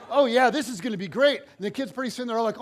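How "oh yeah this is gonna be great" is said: louder, higher, gushing; excited kid. Retold or imitated. Kids cheering for the coach's plan, loud and high. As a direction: hype it up like an eager kid.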